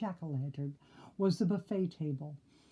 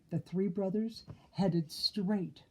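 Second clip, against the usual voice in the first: usual speaking voice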